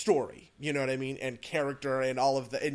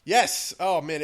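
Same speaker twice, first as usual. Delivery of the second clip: loud, higher burst, then quick follow-on; excited recognition; 3 semitones higher, 10 dB louder, slower than usual. Real, not acted. Co-host asked about a much-discussed game, excited. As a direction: jump in eagerly with real excitement.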